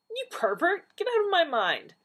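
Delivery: high, loud outraged shout; outraged yell. Acted. Donna's outraged outburst.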